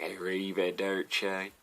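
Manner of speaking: slow, hammed-up American stab at Italian, "Arrivederci" drawn out; bad Italian. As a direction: say "Arrivederci" like a clumsy undercover American. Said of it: "he walks up like".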